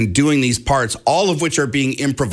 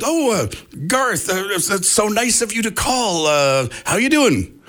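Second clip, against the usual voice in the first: starstruck and speechless; 9 semitones higher than usual. Retold or imitated. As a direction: stammer like a fan hearing his idol. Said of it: "kind of speechless".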